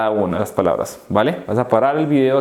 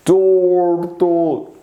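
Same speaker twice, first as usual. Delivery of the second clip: TURTLE stretched very long, higher; slow motion; 4 semitones higher than usual. Shown as a demonstration. Teacher showing pupils slow motion, slow motion. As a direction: drag out both syllables of TURTLE. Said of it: "Slow motion, you see?".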